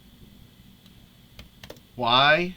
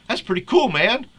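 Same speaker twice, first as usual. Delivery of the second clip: high, loud amused exclamation; hammed-up cartoon enthusiasm; excited; 8 semitones higher, 5 dB louder than usual. Reading Homer-style reply.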